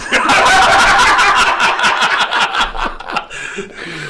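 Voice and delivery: long, high cackling laugh; loud, drawn-out cackle; big laugh. Real, not acted. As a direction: burst out laughing at your own story. Storyteller cracks up at own story.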